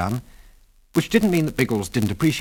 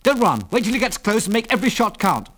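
high, urgent commands; urgent jerked-out orders; 11 semitones higher than usual. A narrator's reading. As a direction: call out orders as danger closes in. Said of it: "jerked out Biggles".

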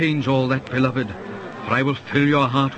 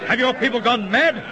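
frightened; 9 semitones higher than usual. Acted. Crowd crying for his blood.

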